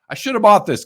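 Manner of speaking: high, loud cry of regret; exasperated regret. Real, not acted. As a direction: belt out the regret, kicking yourself. Regret over a missed card.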